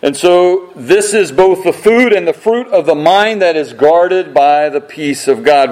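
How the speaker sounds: usual speaking voice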